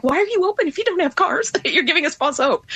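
high-pitched, fast mock indignation; exasperated complaint. Retold exasperated complaint to rental agents.

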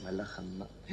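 low, hushed echo; stunned. Acted. Patient learning he faces the Angel of Death, stunned. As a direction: let it sink in under your breath. Realises who the stranger is.

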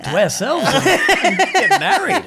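high, loud laughing mock line; giddy mock of a wedding promise; laughing through quote. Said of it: "I was like".

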